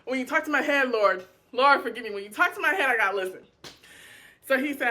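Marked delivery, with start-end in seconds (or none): 0.0-3.4 exclaiming to God. Retold or imitated. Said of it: "I said".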